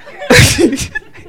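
loud, high burst of laughter; real high laugh. Real, not acted. Laughing at the pastor's check.

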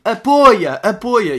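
high, loud repeated "Apoia"; retold rugby yell. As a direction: bark the call across the pitch. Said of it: "Tá tudo a gritar".

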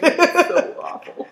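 slow, high words through laughter; laughing groan. Real, not acted. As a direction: drag it out while laughing. Laughing at the straw image.

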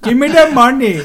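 high, loud, laughing fake European accent; mock Western European accent. Host mimics the robber's demand in a fake European accent. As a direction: ham up a vague European robber voice. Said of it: "Western European".

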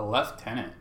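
lieutenant echoed as "lef-TEN-ant"; British "leftenant". Retold or imitated. Friend repeating the unfamiliar rank, British "leftenant". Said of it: "They say lieutenant".

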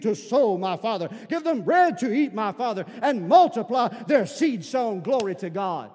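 imitated African pastor's prayer, building to high, loud fervor; Pastor Fred. African pastor praying for Arkansas church, building fervently. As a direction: start measured, swell into full-throated pleading. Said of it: "in that deep African voice, he's praying".

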